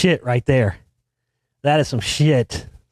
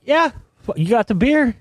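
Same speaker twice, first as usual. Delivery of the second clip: eager acceptance; 8 semitones higher than usual. Retold or imitated. Accepting the challenge, retold.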